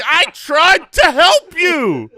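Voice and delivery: high, slow, loud cry; mock wail. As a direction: stretch it into a mock-anguished shout. Acts out a line to the driver.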